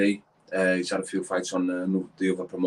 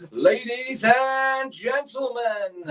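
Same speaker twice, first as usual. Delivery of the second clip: drawn-out, high announcer call with a laugh; ring announcer voice; much slower than usual. Pretend. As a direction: boom it out like a fight-night MC. Mock ring announcer intro.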